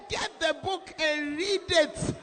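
booming, rising scold; preacher shouting advice at a young man; loud rebuke. Retold or imitated. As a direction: bellow it like a stern pastor. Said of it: "I said".

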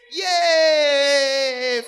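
ordinary delivery

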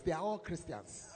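ordinary talk

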